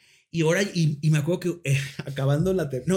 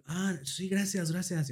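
soft, small thank-you; quiet retold politeness; imitated meek thanks; 3 semitones higher, 7 dB quieter, slower than usual. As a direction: thank them timidly, under your voice. His relieved, meek thanks to fan.

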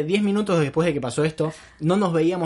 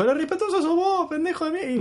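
1 mocking the official. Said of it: "Y me dijo".

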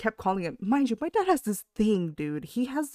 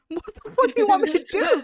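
exasperated outburst; 14 semitones higher, 7 dB louder than usual. Retold or imitated. Exasperated retort to dad.

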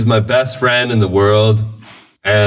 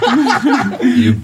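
laughing; 10 semitones higher than usual. Real, not acted. Laughing mock insult at friend.

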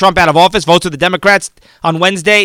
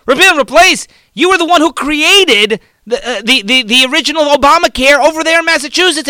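a higher, mocking retort; mocking Obama; 7 semitones higher than usual. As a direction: throw the question back at him, mock-indignant. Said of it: "and Obama says".

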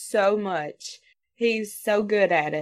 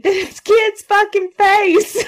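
high, loud, slow laughing exclamation; laughing mock disgust; mock outraged notes; 11 semitones higher, 7 dB louder, much slower than usual. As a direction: read the note out with a laughing squeal. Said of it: "in the notes saying".

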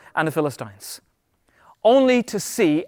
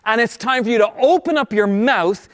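rousing preaching; 5 semitones higher than usual. Real, not acted. Preacher challenging people paralyzed by words, rousing exhortation. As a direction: urge the congregation on with ringing emphasis.

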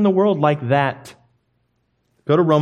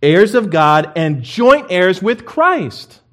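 high, loud, preached-out reading; forceful scripture reading; 6 semitones higher, 6 dB louder, slower than usual. Real, not acted. Preacher reading the verse to the congregation, proclaiming.

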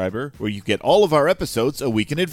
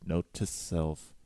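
hushed note-to-self aside; muttered aside; 2 semitones lower, 12 dB quieter, much slower than usual. Acted. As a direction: mutter it under your breath. Muttered aside after promo.

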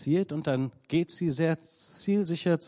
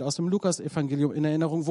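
normal talking voice

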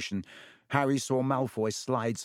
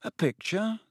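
blank repeat; 11 semitones higher than usual. A narrator's reading. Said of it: "Harry repeated blankly".

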